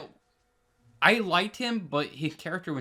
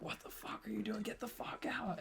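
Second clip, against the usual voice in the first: high, very soft imitation of an outraged outburst; hushed, half-whispered retelling of an angry shout; whispered mock yell; 8 semitones higher, 15 dB quieter than usual. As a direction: yell it under your breath, pitched up. Landlord bursts in, retold as hushed yell.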